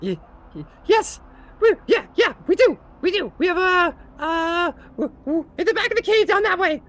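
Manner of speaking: high squeaky stutter; stammering kobold. Acted. Kobold answering the paladin, squeaky stammer.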